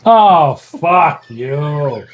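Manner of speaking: usual speaking voice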